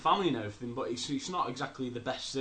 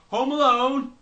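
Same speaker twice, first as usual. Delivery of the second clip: much louder, higher burst; shouted; 13 semitones higher, 15 dB louder, slower than usual. Real, not acted. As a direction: blurt the title out loud. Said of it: "I don't know why I shouted that".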